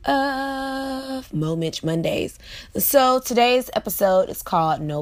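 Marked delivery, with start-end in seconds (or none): 0.0-2.4 drawn-out show title. Acted. Host announcing her weekly show in a drawn-out high voice. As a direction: sing the show name out, high and very slow.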